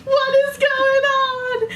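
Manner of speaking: very high, drawn-out loud wail; hammed-up shriek of total bewilderment; freaking out. Viewer baffled by the movie's ending, freaking out. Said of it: "So I'm freaking out".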